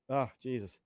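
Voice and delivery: ordinary talk, nothing marked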